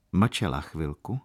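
plain reading voice

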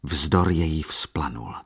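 ordinary delivery

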